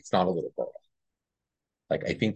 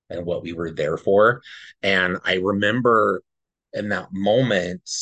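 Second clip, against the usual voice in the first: usual speaking voice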